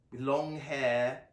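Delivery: usual speaking voice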